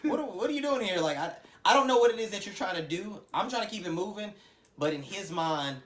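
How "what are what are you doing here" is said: high, laughing "are you okay, man"; incredulous laughing friend. Retold or imitated. Friend asking the hothead what he's doing. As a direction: laugh through a baffled check-in.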